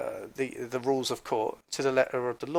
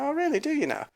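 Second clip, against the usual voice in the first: sarcastic disbelief; 10 semitones higher, faster than usual. Retold or imitated. Sarcastic reply to her claim.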